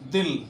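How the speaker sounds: दिल said short and quick, the इ clipped; quick short vowel. Shown as a demonstration. Teacher shows students दिल said short and fast. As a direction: snap out दिल in one quick beat. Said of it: "बहुत जल्दी बोल जाते हैं".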